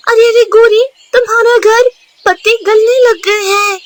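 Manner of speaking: high, loud alarmed cry; alarmed calling out. Acted. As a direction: call out to Gauri in a panic. Neighbour raises the alarm.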